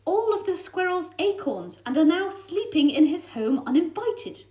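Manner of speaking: cat confronting the fox; firm scolding. Acted. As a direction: stand up to the fox. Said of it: "Frosty said".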